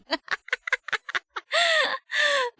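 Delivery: long, high cackling laugh. Real, not acted. Streamer amused by a chat message, long high laughter. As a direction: laugh helplessly in a high pitch.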